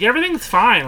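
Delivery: high breezy imitation of wife; mock carefree. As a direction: mimic her breezy dismissal. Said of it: "You were just like".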